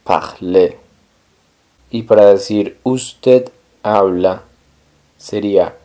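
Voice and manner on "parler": Parler said "par-LAY"; silent final R. Shown as a demonstration. Teacher models the verb, Parler with silent final R. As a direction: end Parler on the E, no R. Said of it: "La R del final no suena".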